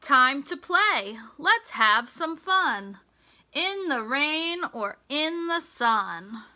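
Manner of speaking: bright, sing-song storybook read; lilting picture-book voice; reading to children. Acted. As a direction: read the rhyme to a class of little kids. Reads rhyming picture book aloud.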